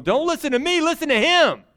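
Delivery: hammed-up Moses pleading; imitating Moses. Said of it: "Moses was going".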